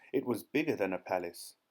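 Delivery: ordinary delivery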